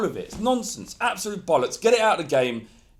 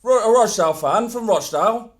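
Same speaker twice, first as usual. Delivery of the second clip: loud, higher Rochdale accent put on for a laugh; hammed-up Rochdale Manchester accent, pitched up and loud; 6 semitones higher, 11 dB louder than usual. Asked to do the accent, mimics a Rochdale fan in a loud, high Rochdale accent. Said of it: "Do the accent".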